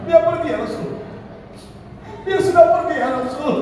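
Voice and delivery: high, pleading lament; grieving widow. Acted. Wife tells the Prophet he is gone, high grieving cry. Acting widow reporting husband's death.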